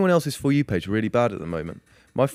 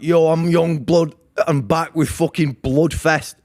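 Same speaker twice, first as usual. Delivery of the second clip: slow, loud, higher mock hype voice; 6 semitones higher, 5 dB louder, much slower than usual. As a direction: drag it out like a hyped-up rocker. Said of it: "And he's like".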